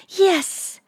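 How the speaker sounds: one high, exclaimed word; eager acceptance. A narrator's reading. Lisa recalling her answer to him, eager high exclamation. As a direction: answer brightly, pitched right up.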